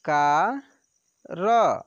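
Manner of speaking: चा and र said apart with long pauses; syllable by syllable. Shown as a demonstration. Teacher spelling out syllables for pupils, slow and separate. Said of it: "तो व में ऐसे छोटी की मात्रा वि".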